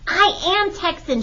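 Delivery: high-pitched, hot-tempered insistence; angry cry. A narrator's reading. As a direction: protest like a cross little girl. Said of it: "she cried".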